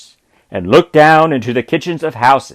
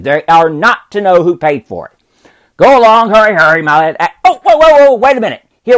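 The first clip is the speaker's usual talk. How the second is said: put-on a lightly raised, eager old man's voice; excited Scrooge; 7 semitones higher, faster than usual. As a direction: hurry the boy off with glee. Scrooge sends the boy.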